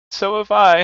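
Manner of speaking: put-on high excited child's cry; excited exclaim. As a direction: burst in high and loud, another child chiming in. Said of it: "exclaimed another".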